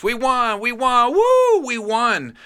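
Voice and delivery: high, loud whooping victory yell; screaming fans. Acted. Three fans in the crowd screaming that they won, loud high yelling. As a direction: whoop like football fans at the final whistle. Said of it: "screaming at the top of their".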